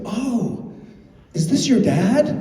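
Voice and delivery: high, surprised fan voice; mock innocent fan question; quoting a fan. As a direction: put on a bright-eyed fan asking. Fan mistakes Billy for dad.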